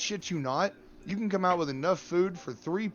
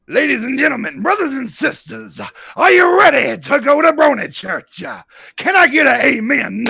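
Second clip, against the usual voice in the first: booming revival preacher hype; hype man; 7 semitones higher, 13 dB louder than usual. Pretend. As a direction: whip the crowd up, higher and much louder. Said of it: "would you allow me to hype you".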